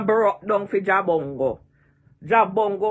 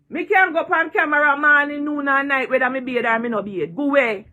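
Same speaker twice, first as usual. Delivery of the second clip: normal talking voice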